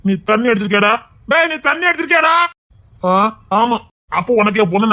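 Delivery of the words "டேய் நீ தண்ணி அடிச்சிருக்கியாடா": high, heated demand; scolding question, pitched right up; heated accusation. Acted. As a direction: raise the pitch and demand an answer. Repeats accusation, more heated.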